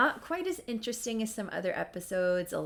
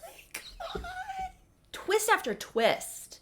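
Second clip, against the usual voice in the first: high squealing laugh, slow and much higher; excited disbelief; slower than usual. Real, not acted. Vlogger thrilled by a plot twist, squealing.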